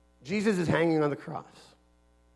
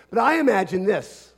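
ordinary talk, nothing marked

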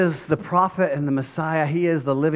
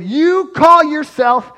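angry accusation; 6 semitones higher, 7 dB louder than usual. Retold or imitated. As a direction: denounce him as a fraud. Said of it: "I'd have gotten angry".

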